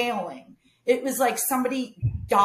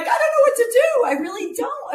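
laughing panic; 14 semitones higher, 7 dB louder than usual. Retold or imitated. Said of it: "I'm like".

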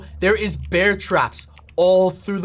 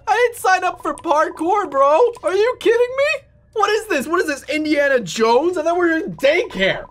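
exasperated shock; 11 semitones higher, 3 dB louder than usual. Real, not acted. Gamer shocked by obstacle course.

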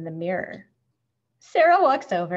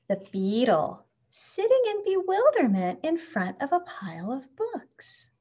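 plain reading voice

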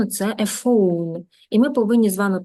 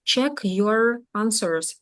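"Check your answers" very slowly, word by word; teacher's slow model of "Check your answers"; separated words; much slower than usual. Shown as a demonstration. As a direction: leave gaps between "check", "your" and "answers". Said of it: "повинні їх з'єднати вимовою".

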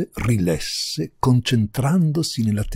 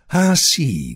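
loud, raised retort; shouting; 4 semitones higher, 7 dB louder than usual. A narrator's reading. Countess rounding on the visitor, shouting. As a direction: cry it out indignantly. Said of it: "gridò".

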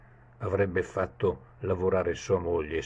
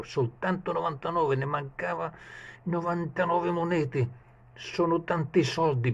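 a much higher, strained voice for the servant; fretting page; 9 semitones higher than usual. Acted. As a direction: worry aloud like a greedy servant. Page's anxious thoughts voiced.

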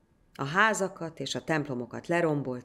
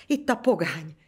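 gasped out; 3 semitones higher, 5 dB louder than usual. A narrator's reading. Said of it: "nyögte ki végül".

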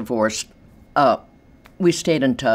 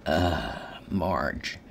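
knowing groan; 8 semitones lower, 7 dB quieter than usual. Retold or imitated. Realising jealous wife's name.

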